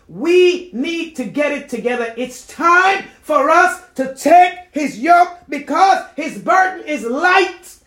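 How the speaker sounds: loud, high shouted exhortation; full-force preaching. Real, not acted. Calling people of God to take the yoke, full-force preaching. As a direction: shout it to the congregation, landing each phrase.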